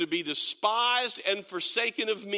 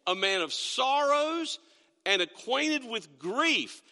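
scripture reading; 4 semitones higher than usual. Acted. Pastor reads Isaiah aloud.

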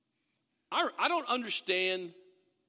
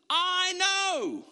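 mock smug; 10 semitones higher, 4 dB louder than usual. Mocking self-reliant unbeliever.

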